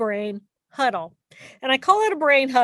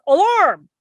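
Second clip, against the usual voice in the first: voicing the panicked emotional brain, raised and urgent; panicked inner voice; 3 semitones higher, 4 dB louder than usual. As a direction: sound the alarm like a frightened child. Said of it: "my little character two goes".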